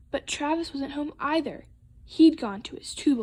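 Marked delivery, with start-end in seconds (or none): none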